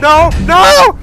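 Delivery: screaming no in terror; panicked scream. Real, not acted. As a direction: scream it out, terrified. Chased by monster, screaming.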